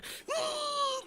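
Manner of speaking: long, high-pitched gasp; mock gasp. Host reacting to Trump's ill-timed name-calling, mock gasp. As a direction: draw out a high, pained gasp.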